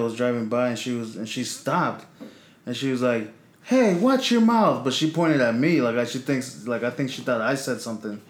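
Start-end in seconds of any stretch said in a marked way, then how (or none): 3.6-4.8 high, drawn-out mock scolding; retold scolding. Passing lady scolds a teenager, retold in high mock reprimand. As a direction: imitate an indignant lady telling someone off.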